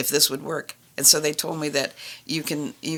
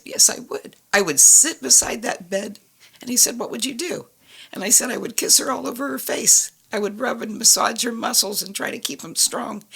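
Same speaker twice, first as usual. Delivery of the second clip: higher, pleading insistence; insist, voice lifting higher; 7 semitones higher than usual. Retold or imitated. A mother insisting to God she'd never leave, higher and pleading. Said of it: "I kept insisting I would never leave".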